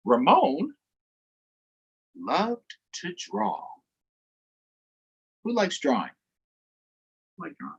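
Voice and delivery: put-on lively read-aloud for young kids; story read to children. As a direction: read the picture book with animated voices. Reading picture book aloud to children.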